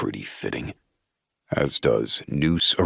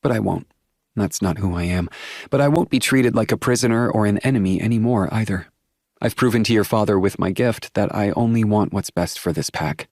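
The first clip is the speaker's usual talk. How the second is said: put-on young woman voice; 5 semitones higher, faster than usual. A narrator's reading. Willow declares her resolve.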